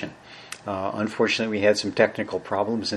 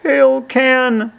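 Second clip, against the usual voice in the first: high, loud, drawn-out squeak of a voice; squeaky Tin Woodsman; 14 semitones higher, 7 dB louder than usual. Acted. Reader mimics Tin Woodsman wanting his oil can, high squeaky voice.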